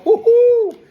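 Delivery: loud, high "woo-hoo"; big falsetto-high holler of delight; thrilled whoop. Real, not acted. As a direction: cheer out loud over the food. Thrilled at the finished plate.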